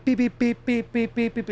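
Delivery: high-pitched string of beeps; imitated EMF beeping. Retelling the reader going off again, imitated beeping. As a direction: mimic an alarm going off, high and even. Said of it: "it starts going off again".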